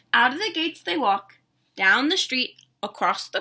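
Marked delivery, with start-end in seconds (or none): none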